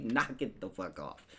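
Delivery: normal talking voice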